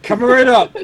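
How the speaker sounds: bright, loud bartender voice; chirpy server calling out an order; cheerful bartender. Pretend. Playing bartender serving.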